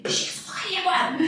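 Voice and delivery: quick, laughing mock scold; hammed-up fed-up mother, fast; mother telling her son to drop the game, laughing exasperation; exasperated order.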